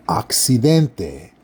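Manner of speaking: accidente slowly in Latin American Spanish, crisp KS. Shown as a demonstration. Teacher modelling Latin American Spanish accidente. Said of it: "when you say the word for accidents".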